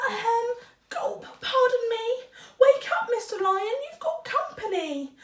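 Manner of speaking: high, nervous little mouse voice; a tiny mouse gulping through his request; timid squeaky mouse. Acted. Mouse nervously asks the sleeping lion to teach him.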